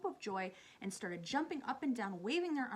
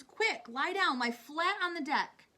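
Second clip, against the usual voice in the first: urgent high shouted orders; crying out; 8 semitones higher, 10 dB louder, slower than usual. A narrator's reading. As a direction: shout them down to safety. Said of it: "cried James".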